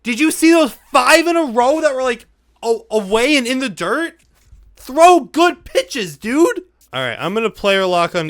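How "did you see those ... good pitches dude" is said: high, loud exasperated yelling; angry rant. Real, not acted. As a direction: yell at the pitcher in disbelief. Ranting at his pitcher.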